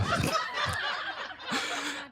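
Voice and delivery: breathless high-pitched giggle; high squeaky giggle. Real, not acted. Comic laughing at her own search list, high squeaky giggle. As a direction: giggle in a tiny airy squeak.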